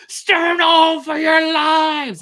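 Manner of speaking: slow, high, very loud warning cry; shout. A narrator's reading. Warning the crew to stand clear, booming high shout. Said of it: "I shouted".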